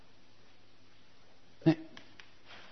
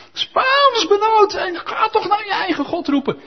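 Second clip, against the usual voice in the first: panicked ship's captain; 5 dB louder than usual. Acted. Captain waking Jonah, high panicked wail. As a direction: wail it like a frightened skipper.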